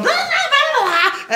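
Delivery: very high, laughing gibberish; imitating drunk friends babbling over him; mock squeaky chatter. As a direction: babble like a noisy oblivious crowd. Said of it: "they're all still going".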